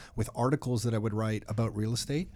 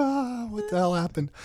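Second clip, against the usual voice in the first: a higher, shaken cry of disbelief; stunned disbelief; 9 semitones higher than usual. Real, not acted. Host after hanging up on the site thief, stunned. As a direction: reel from what just happened. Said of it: "shaking on the phone".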